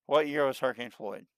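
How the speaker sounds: ordinary talk, nothing marked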